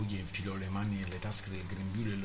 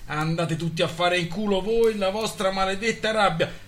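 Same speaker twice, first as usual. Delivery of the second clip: furious yell; 11 semitones higher, 9 dB louder than usual. A narrator's reading. Husband keeps yelling at voices.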